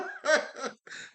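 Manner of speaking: high breathy chuckle; short burst of high, airy laughter; real amused chuckle. Real, not acted. Chuckles at own digression.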